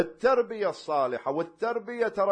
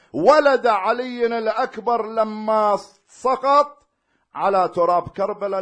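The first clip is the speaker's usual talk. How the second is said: loud, slow fervent sermon cadence; preaching at full force; 4 semitones higher, 7 dB louder, slower than usual. Acted. Preacher to the mourners about Ashura, loud fervent preaching. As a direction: proclaim each virtue of Ashura to the hall.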